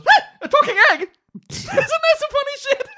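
startled egg. Acted. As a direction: yelp it in comic shock.

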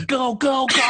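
high, loud mock shout; hammed-up frantic urging; mock urgent yell. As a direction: urge a getaway driver on, playfully. Co-host mimics urging the driver.

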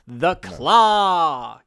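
long drawn-out grand announcement; dramatic reveal. Acted. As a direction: stretch CLAW out like a triumphant fanfare.

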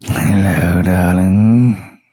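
low, slow purring seductive voice; breathy vintage purr. Acted. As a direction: purr each word like a silky villain. GM voices Zul Grubub.

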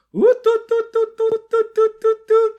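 very high, loud repeated hoots; rapid-fire falsetto whoops, all on one note; imitated drunken whooping. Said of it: "he whoops into the microphone".

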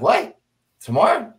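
retold shock, voice jumping high; two high, startled questions with a rise at the end; startled disbelief. Captain asked to brief the Pentagon tomorrow, taken aback.

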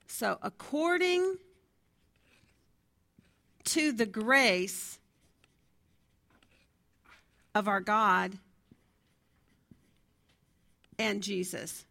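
slow, pausing between each chunk of So according to the grace of our God and Jesus; teacher spacing out the key phrase with long pauses. Shown as a demonstration. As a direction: lay out According to the grace one piece at a time. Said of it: "spells out the phrase for class".

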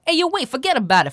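fast, high, loud New York accent. Shown as a demonstration. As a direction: rattle it off like a brash New Yorker. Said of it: "from New York".

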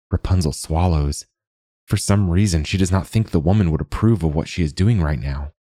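plain reading voice

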